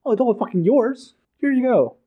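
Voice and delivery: sarcastic tyrant handing it over; mocking Calor. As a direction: toss the whole empire away, hammed up. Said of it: "As he says".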